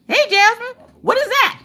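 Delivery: high-pitched call to Jasmine; high, questioning holler. Real, not acted. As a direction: call out sharply to someone nearby. Calling out to Jasmine.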